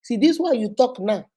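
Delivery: ordinary talk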